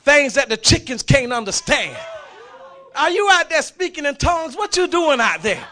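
high, very loud preaching shout; shouting preacher climax. Acted. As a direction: lift the church with a full shout. Preaching the eagle's cry.

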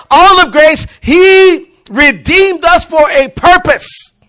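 loud, high shouting sermon; rally the church, hammering every line; fiery pulpit preaching; preaching at full force. Acted. Preaching builds to a shouting climax.

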